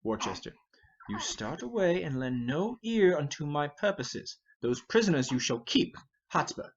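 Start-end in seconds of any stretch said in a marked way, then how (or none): none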